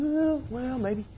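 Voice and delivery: put-on high, soft, drawn-out kid's reluctance; hesitant kid. Father imitates his kids at a jump, high hesitant voice. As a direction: imitate a child wavering, high and light. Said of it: "my kids are, like, coming up here and they're going".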